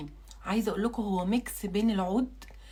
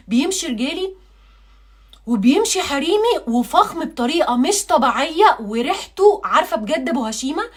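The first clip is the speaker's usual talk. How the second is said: excited; 5 semitones higher, 7 dB louder than usual. Real, not acted. Raving about the scent.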